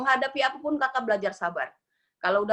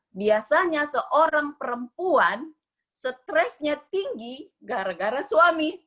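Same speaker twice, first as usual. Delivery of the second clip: slow explaining; 4 semitones higher, much slower than usual. Retold or imitated. Doctor explains slowly.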